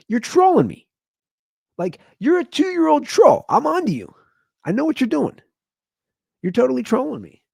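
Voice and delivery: very high, exaggerated playful accusing; hammed-up squeal of catching a little trickster; mock accusation. Parent to two-year-old at bedtime, mock accusing.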